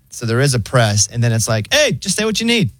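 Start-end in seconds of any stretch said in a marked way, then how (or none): 1.7-2.7 high, easygoing AI phone-bot voice; chill robot voice. Retold or imitated. Pharmacy AI phone bot greeting the caller, high and chill. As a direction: play the breezy automated assistant. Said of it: "the robot voice is, like, so chill".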